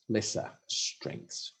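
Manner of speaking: lesser strengths whispered, very slow and drawn out; mocking whisper. Shown as a demonstration. Mocking the report's euphemism, whispered slowly. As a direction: breathe lesser strengths slowly, with wry scorn. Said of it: "they call them lesser strengths".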